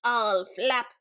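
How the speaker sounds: big bad bird voice; screeching eagle. A narrator's reading. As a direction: screech the threat in two bursts. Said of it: "The eagle landed and screeched".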